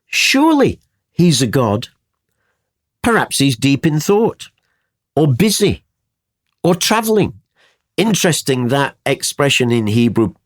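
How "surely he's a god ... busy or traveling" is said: mildly mocking, higher-pitched suggestions; taunting. A narrator's reading. Elijah mocks the prophets' absent god, taunting. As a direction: needle them with pauses between each suggestion. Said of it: "Elijah began to taunt them".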